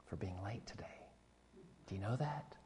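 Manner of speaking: hushed, reassuring whisper. Real, not acted. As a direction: drop to a whisper. Gentle point to late family.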